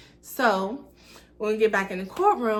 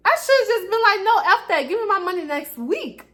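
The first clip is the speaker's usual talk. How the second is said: higher and louder, calling her out; mock angry demand; 9 semitones higher, 4 dB louder than usual. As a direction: snap back at her for the money.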